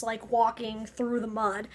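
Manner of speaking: normal talking voice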